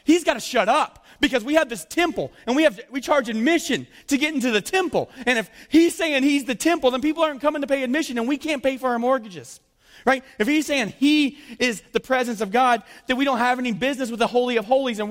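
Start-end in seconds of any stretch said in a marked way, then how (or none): 0.0-9.6 indignant rant. Acted.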